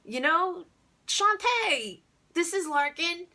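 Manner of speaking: high, loud cartoon announcer of guests; hammed-up, hollered introductions; the Wolf. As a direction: introduce them like an excited clown. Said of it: "like a human cartoon called the Wolf".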